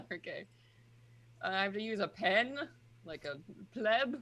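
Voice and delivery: usual speaking voice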